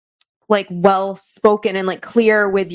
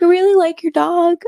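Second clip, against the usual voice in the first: lightly voiced polite child, higher; a hint of a sweet small boy asking nicely; polite little kid; 9 semitones higher, 3 dB louder than usual. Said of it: "He was just like".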